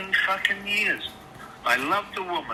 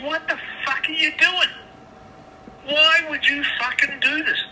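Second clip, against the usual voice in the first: much higher, retold angry screech; re-enacted angry scream; 10 semitones higher than usual. Husband re-enacting her kitchen fight scream, higher mock screech. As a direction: replay her furious cry exactly as it happened. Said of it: "I'm saying this exactly like I said to her".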